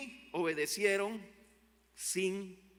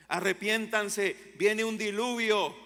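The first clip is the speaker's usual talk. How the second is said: louder, slow prophetic call; louder, slower preacher's warning of the coming flood; Noah's warning cry; 5 semitones higher, 8 dB louder, slower than usual. Acted. Noah preaching warning to crowd.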